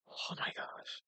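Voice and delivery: exasperated whisper; real whispered exasperation; whispered aside. Real, not acted. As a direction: breathe it out under your breath.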